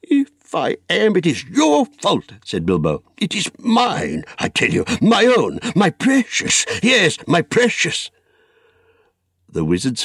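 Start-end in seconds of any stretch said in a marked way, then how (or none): 0.0-2.3 high, drawn-out blame; heated, accusing retort; angry. A narrator's reading. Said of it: "no need to get angry". 3.2-8.1 high, drawn-out obsessive claim; fierce, covetous insistence; possessive anger. Acted. Bilbo angrily claims the ring.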